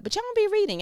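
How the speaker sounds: high, loud exasperated scold; God teasing her for not reading; mock exasperated God. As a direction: playfully scold them as God.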